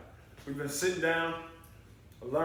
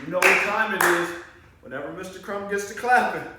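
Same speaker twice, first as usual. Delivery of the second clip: loud hype; 4 semitones higher, 8 dB louder than usual. Real, not acted. Hyping kids before rope climb.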